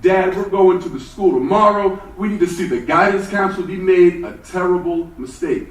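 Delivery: put-on loud, outraged kid demanding a meeting; young [name] loudly protesting his grades to his parents; indignant kid. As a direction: insist loudly that the school got it wrong. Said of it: "I said".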